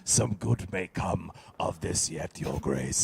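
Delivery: low, slow, lightly voiced schemer; a hint of a scheming old advisor; Otto Hightower impression. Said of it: "what a good impression".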